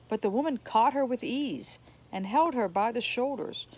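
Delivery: usual speaking voice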